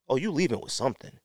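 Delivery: normal talking voice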